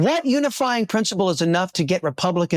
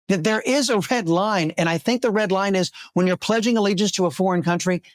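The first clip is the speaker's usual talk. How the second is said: normal talking voice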